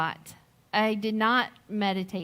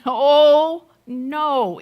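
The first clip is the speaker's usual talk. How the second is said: loud, higher "Oh", falling "no"; emphatic refusal; 3 semitones higher, 11 dB louder than usual. Retold or imitated. Mother flatly refusing the son's idea of calling Dad, loud exclamation. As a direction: blurt it out like a cuss word was said.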